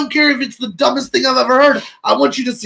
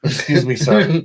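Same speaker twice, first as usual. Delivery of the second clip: polite customer voice; 8 semitones lower, 4 dB quieter, slower than usual. Pretend.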